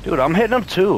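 loud, high frustrated yell; frustrated shout. Real, not acted. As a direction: yell it in disbelief. Frustrated callout mid-fight.

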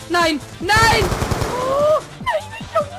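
rising panicked cries of no; panicking gamer. Real, not acted. Dying in game, panics. (panisch, immer höher)

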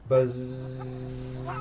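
long, steady low buzz; bee buzz. Pretend. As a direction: drone softly like a swarm of bees. Quiz on bee sound.

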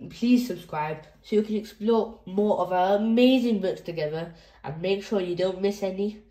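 ordinary delivery

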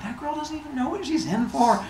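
high, smug aside; Athena. Acted. Athena sizing up her rival, high-pitched and smug. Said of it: "And she's like".

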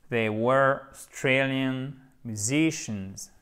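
slow, carefully articulated English model; prononciation modèle. Shown as a demonstration. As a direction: say it slowly, word by word, for learners. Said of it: "il y a plein de pièges au niveau prononciation". (prononciation anglaise modèle, lente et soignée)

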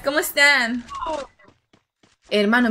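usual speaking voice

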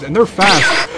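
normal talking voice

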